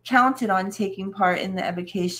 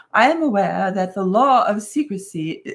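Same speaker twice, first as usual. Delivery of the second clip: hammed-up English accent, louder; strongly marked English accent; 6 dB louder than usual. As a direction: put on a loud, exaggerated English accent. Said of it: "with a strongly marked English accent".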